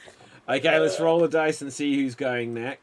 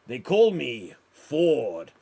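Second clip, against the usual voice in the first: slow lower rival; 5 semitones lower, much slower than usual. Acted. Improv, young rival introduces himself.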